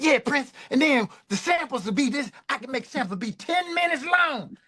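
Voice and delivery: eager bragging. Retold or imitated. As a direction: show off to the boss, overeager. Bragging about tech.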